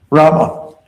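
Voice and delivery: loud, high warrior chant call. Shown as a demonstration. Leader cues group to release the arrow, warrior call. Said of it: "the warrior in you is gonna come out".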